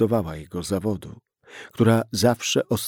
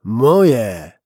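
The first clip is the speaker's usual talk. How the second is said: loud single word, falling hard; insistent; 8 dB louder than usual. Acted. Gunman insisting to the policeman the kids are his, loud and insistent. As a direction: slam the word down.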